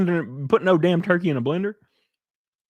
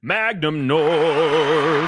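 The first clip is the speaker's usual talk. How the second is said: brand shout; 2 semitones higher, much slower than usual. Acted.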